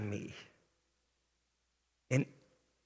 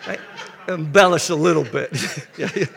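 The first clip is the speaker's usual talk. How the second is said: laughing; 3 semitones higher, 6 dB louder, faster than usual. Real, not acted. Admits exaggerating, laughs.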